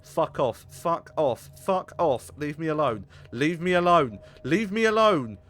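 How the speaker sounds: building panicked yells; panicking gamer. Real, not acted. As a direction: yell at it to leave. Yelling at pursuing creature.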